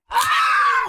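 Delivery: shrill, loud scream of frustration; rage scream. Real, not acted. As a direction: let out a furious shriek. Losing it all again.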